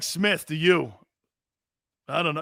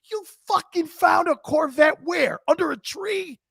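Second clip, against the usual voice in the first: furious coach yelling; 12 semitones higher, 4 dB louder than usual. Retold or imitated. Coach yelling at player about the Corvette. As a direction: scream in disbelief at him.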